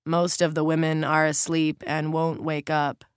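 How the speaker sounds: ordinary delivery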